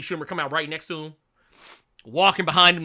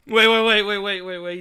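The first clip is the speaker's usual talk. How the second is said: ordinary talk, nothing marked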